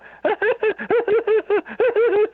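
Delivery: high cackling laugh imitating a hyena. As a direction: whoop it up like a hyena. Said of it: "almost like a damn hyena".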